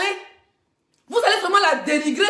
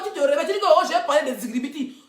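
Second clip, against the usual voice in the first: ordinary talk, nothing marked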